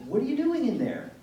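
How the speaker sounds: loud, high call through a door. Retold or imitated. Mom calls to her son in his bedroom, loud and high. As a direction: shout it to a closed bedroom.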